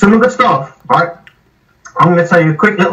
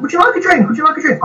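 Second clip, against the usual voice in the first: much higher, sing-song offer of a drink; polite canteen lady; 14 semitones higher, faster than usual. Retold or imitated. Telling of the office lady offering him a drink, high sing-song. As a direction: put on a chirpy tea lady voice twice over.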